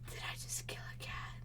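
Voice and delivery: fast, very soft whisper; guilty hushed whisper; horrified whisper. Real, not acted. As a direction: breathe it out in guilty shock. Horrified at own kill.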